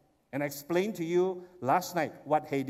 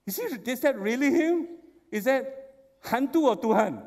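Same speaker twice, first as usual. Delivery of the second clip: disbelieving; 6 semitones higher, 5 dB louder than usual. Acted. Disciples wondering ghost or Lord.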